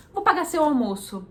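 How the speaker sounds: cheerful offer. Retold or imitated. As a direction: offer to pay lunch gladly. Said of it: "a pessoa fala". (oferta animada, mais alta)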